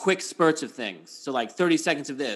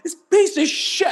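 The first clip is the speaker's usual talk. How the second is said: high, loud exasperated curse; furious yell; 7 dB louder than usual. Retold or imitated. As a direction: explode at the broken gadget. Cursing the speaker.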